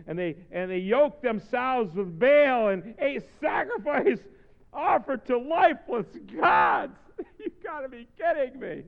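loud, high, slowed scripture reading; incredulous preaching. Real, not acted. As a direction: proclaim the verse in loud disbelief.

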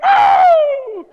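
wife's high, loud woo; imitated cheerful whoop. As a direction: mimic a late-night burst of cheer. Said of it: "and she's like".

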